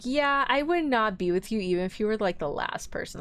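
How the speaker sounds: ordinary talk, nothing marked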